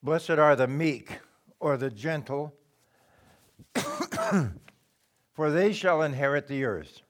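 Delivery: measured scripture recitation; scripture reading. Acted. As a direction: read it out as holy writ. Reads scripture.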